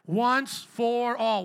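loud, drawn-out slogan, word by word; big slow chant-like declaration; congregational call-out. Real, not acted. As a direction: lead the crowd in saying it, loud and very slow. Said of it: "Say it again with me".

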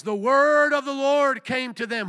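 high, loud proclamation; full-force preaching yell; preacher's scripture shout. Real, not acted. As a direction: thunder the quote from the pulpit.

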